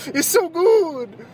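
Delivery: high, very slow laughing squeal; laughing gush. Real, not acted. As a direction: squeal it through a laugh like a food addict. Laughing, gushing over the food.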